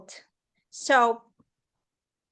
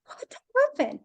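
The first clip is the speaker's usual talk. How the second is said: alarmed question; 11 semitones higher than usual. Retold or imitated. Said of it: "turned on my computer".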